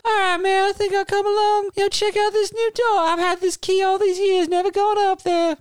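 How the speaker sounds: very high, eager rock-singer voice; a falsetto wannabe rock star; lead singer voice. Acted. A lead singer pitching his band to the player, very high and eager. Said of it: "the lead singer of the band now interrupts".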